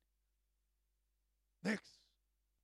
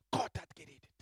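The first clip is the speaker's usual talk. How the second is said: breathy awed whisper, no voice; hushed whisper; 6 dB louder, much faster than usual. Acted. Preacher to congregation, whispering God's word. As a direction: whisper it like a holy secret. Whispered then repeated aloud.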